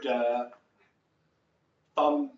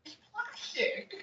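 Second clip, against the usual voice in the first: whiny actor voice; 6 dB quieter, slower than usual. A narrator's reading. Voicing the actors.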